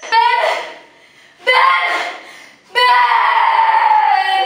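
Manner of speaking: loud, high, hammed-up cries for help; panicked shouting. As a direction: call the neighbours in mock panic. Acted panic over dying Ben. (eksaheradong sigaw ng nagpapanik)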